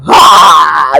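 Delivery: short loud imitated wail of a crying kid; a loud burst like a child crying out; mock child's wail. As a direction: let out a quick mock baby cry, low and loud. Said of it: "our kids cry".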